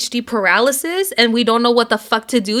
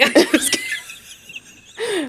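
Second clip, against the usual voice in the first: laughing; 7 semitones higher, much slower than usual. Real, not acted. Laughing slow drawl.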